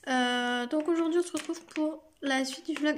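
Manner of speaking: normal talking voice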